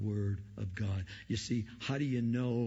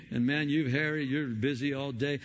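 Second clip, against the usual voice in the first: usual speaking voice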